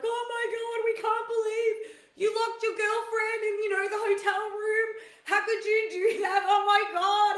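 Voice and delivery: high, loud, teasing mock outrage from the boys; mocking friends. Friends teasing Johnny about the locked door, high hammed-up mock shouting. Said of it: "They're like".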